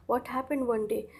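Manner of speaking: ordinary talk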